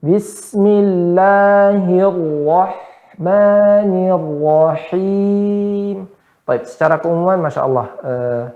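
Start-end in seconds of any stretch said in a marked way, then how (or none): 0.0-6.1 very slow, measured recitation; slow Quran recitation. Shown as a demonstration. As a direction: recite it plainly and slowly, every letter clear. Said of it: "Ya, biasa saja".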